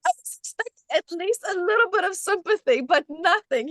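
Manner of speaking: normal talking voice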